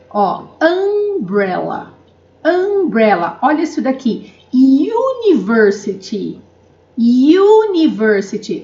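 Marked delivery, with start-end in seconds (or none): 0.6-3.4 umbrella with an open "uh" start, stretched slowly; English umbrella. Shown as a demonstration. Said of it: "Lembra que eu falei do umbrella?". 4.5-8.6 University starting with a clear "you" sound, said slowly; English university. Shown as a demonstration. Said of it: "Eu falo iu".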